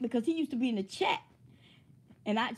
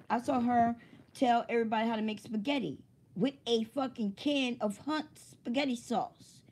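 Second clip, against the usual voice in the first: ordinary talk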